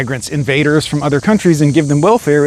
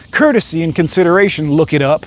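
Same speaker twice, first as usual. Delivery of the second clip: usual speaking voice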